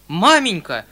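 loud despairing outcry; desperate cry. Acted. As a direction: cry out at his mother, at wit's end. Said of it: "вскричал дядя в совершенном отчаянии". (громкий отчаянный вскрик)

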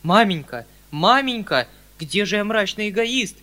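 loud, high desperate plea; near despair. A narrator's reading. Uncle protests to his mother, nearly in despair. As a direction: cry out almost in despair. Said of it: "почти в отчаянии".

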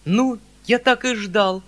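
loud, high cry of dismay; exclaiming. A narrator's reading. Uncle cries out, expecting bad news, loud exclamation. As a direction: burst out as if proven right. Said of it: "вскричал дядя".

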